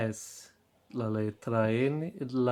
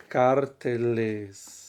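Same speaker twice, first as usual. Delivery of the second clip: slow teaching demo; slower than usual. Shown as a demonstration. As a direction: stretch "carteles" slowly and clearly. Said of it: "teacher models the plural slowly".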